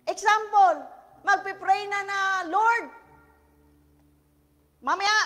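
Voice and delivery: loud, high preaching burst. Acted. Pastor preaches to congregation at full force. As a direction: preach it out to the crowd.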